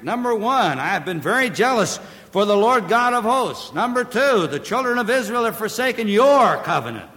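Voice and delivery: high, loud pulpit count; forceful preaching list. Real, not acted. Preacher listing Elijah's complaints with force. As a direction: hammer out each point.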